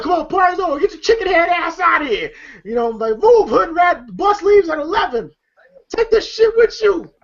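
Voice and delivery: high, commanding mock holler; imitated bossy shooing. Joker throwing them out, imitated bossy hollering. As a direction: order them out, playing it up.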